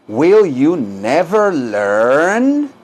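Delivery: extremely drawn-out question, long rising last word; slow repeat-after-me model. Shown as a demonstration. Teacher models the question for students, extremely slow. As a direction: stretch every word right out. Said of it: "Díganlo".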